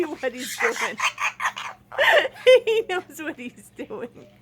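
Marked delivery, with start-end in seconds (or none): 1.0-2.6 high, loud laugh. Real, not acted. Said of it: "it makes me laugh". 2.7-4.3 said through laughter, softer; laughing. Real, not acted. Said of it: "it makes me laugh".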